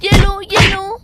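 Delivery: loud scolding yells; playful angry shout. Acted. Simran beating Raj with a basket, shouting. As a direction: punish him with each blow.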